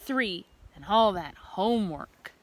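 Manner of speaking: plain reading voice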